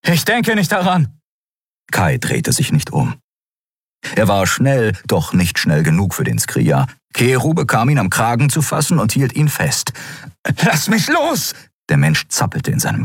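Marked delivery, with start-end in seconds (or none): 0.1-1.2 high-pitched, agitated retort; defiant refusal. A narrator's reading. Fleeing man refuses to stop. 10.6-11.5 high, agitated cry; struggling protest. A narrator's reading. Man struggles to get free.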